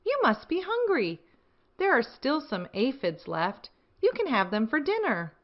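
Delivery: friendly ladybug. Acted. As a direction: coax a tired friend to eat. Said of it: "said the friendly ladybug".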